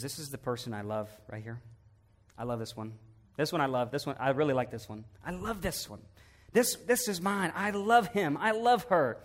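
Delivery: soft, hushed words of love; tender pride. Acted. God proudly presents the believer in heaven: soft and tender. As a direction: murmur it like showing off someone precious.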